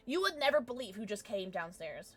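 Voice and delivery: usual speaking voice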